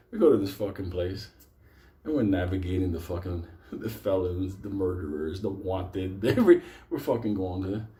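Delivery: ordinary delivery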